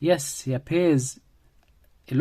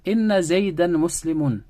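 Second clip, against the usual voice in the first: Inna Zaydan Muslimun with a higher, louder punch on Inna; emphatic assertion; 5 semitones higher, 4 dB louder than usual. Shown as a demonstration. Teacher convincing a doubter, emphatic stress on Inna. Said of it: "So I'm emphasizing to this person".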